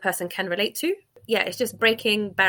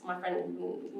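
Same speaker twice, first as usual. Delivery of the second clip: usual speaking voice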